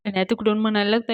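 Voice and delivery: usual speaking voice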